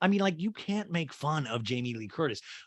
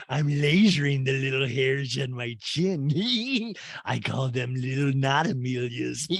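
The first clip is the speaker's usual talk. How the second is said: slow, giggly caricature; Rinna. Acted. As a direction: drawl it slowly, tack on a silly giggle. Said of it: "And Rinna's like".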